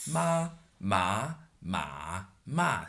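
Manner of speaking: ma said in first, second, third and fourth tone in turn. Shown as a demonstration. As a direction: step through each tone on ma. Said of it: "the famous four tones".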